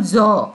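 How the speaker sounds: "जॉ" said as a buzzing zaw; dotted z sound. Shown as a demonstration. Teacher shows the dotted letter, "जॉ" as z. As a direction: make "जॉ" a z, as in English zed. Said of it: "उच्चारण करते हैं हम".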